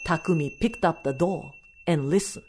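ordinary delivery

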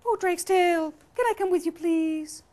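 high, sing-song pleading; pleading ladder voice. Acted. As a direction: beg in a very high character voice. Ladder begs to come along.

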